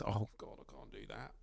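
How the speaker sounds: very quiet, under-the-breath imitation of a reluctant speaker; hushed, retold self-doubt; daunted mock reluctance. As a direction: voice someone shrinking from the task, almost inaudibly. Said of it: "some people, you know".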